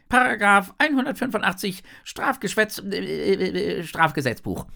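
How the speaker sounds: high, loud, bumbling officer; second shark policeman. A narrator's reading. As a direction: cite the law officiously, stumbling at high pitch. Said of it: "meint eine andere". (übereifriger Polizist mit hoher Stimme)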